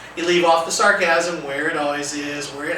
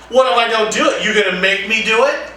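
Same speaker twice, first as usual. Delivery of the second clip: louder, higher taunting dare; defiant challenge; 4 semitones higher, 6 dB louder than usual. Acted. Defiant student daring the teacher to enforce it, loud taunt. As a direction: dare the adult to make you. Student defying staff demo.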